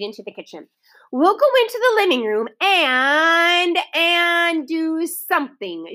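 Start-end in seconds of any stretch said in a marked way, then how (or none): 1.1-5.9 trailing off, slowing to nothing; lame. A narrator's reading. Big sister unsure how to finish her plan for the children. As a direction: run out of ideas halfway. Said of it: "she finished lamely".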